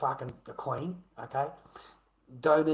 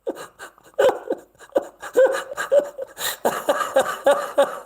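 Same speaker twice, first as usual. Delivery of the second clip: long high scornful laugh; mocking laugh; 13 semitones higher, 9 dB louder than usual. Real, not acted. Laughing at the commenter who hit his wife. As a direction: cackle at him in disbelief.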